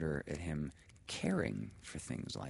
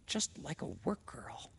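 whispering; 7 semitones higher, 4 dB quieter than usual. A narrator's reading. Hushed inner thought.

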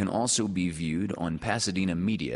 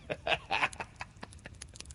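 soft laugh; 7 semitones higher, 9 dB quieter than usual. Real, not acted. Laugh after reading the ending.

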